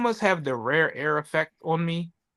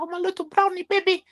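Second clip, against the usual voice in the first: much higher doting grandmother voice; doting grandma Lola. Retold or imitated. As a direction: coo it like a grandma over a baby. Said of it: "She be like".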